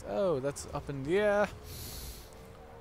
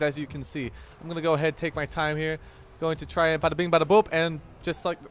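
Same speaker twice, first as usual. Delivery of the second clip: ordinary talk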